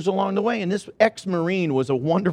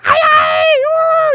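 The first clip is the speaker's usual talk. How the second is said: piercing high wail like a movie banshee; high banshee shriek; banshee screech; 7 dB louder than usual. Retold or imitated. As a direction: screech it out like a ghost. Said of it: "something like that".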